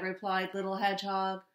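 ordinary delivery

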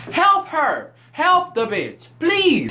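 pleading way up high, half-yelling; high exasperated plea. Real, not acted. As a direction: beg them to help her, voice cracking upward. Pleading with friends to help her.

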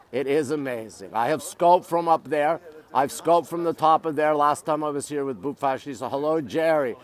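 normal talking voice

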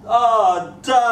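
put-on high, loud boyish protest; reluctant son. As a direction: imitate a kid answering his dad begrudgingly. Boy answers his father's call.

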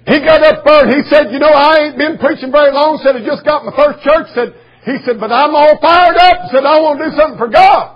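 loud, high preaching shout; fired-up preaching. Acted. Preacher retelling a young boy's sermon, full-force shout.

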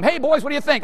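high-pitched mock shout to kids; calling out. Dad asking his sons about the bath, high mock shout.

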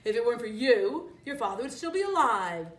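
loud, accusing snarl. A narrator's reading. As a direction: snarl the blame at the cub. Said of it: "Scar snarled".

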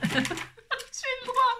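very high, slow, laughing; laughing squeak. Real, not acted. Riant, voix aiguë. (aigu en riant)

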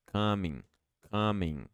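Coming said as comin, no G; dropped G. Shown as a demonstration. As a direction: let the final G fall away. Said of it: "Esse G basicamente não vai ser pronunciado".

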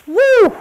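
loud, high "whoo" for the noise; imitated rain whoosh. Host describing rain on the stairwell dome, loud imitated whoosh. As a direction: whoop out the roar of the rain.

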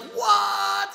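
shocked what. Retold or imitated. Stunned at being recognized.

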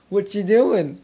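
normal talking voice

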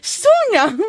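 high, playful, questioning rise; cutesy surprise. Retold or imitated. Hearing the friend's story, high cutesy surprise. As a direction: feign cute disbelief.

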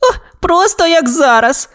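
very high scared squeak; frightened exclamation. A narrator's reading. Toy dinosaur spooked by the story, high frightened exclamation. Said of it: "вигукнув Рекс".

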